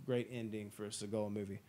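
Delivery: ordinary delivery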